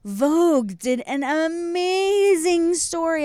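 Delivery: slow emphatic high excited line. Real, not acted. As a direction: stretch it out excitedly. Excited announcement.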